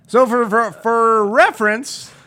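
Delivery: real stutter into a drawn-out finish. Real, not acted. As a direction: stumble and restart on the word "for". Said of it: "I'm stuttering".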